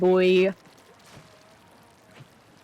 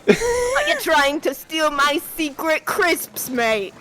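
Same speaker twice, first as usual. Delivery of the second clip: slow mock British; 5 semitones higher, slower than usual. British parody of Plankton.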